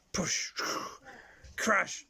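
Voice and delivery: ordinary talk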